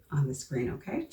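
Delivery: usual speaking voice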